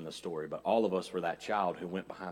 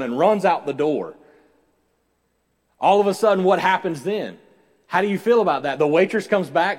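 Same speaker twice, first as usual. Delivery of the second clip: loud, raised-pitch sermon storytelling; impassioned preaching; 8 semitones higher, 12 dB louder than usual. Acted. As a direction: drive the story at the congregation. Preaches story at full force.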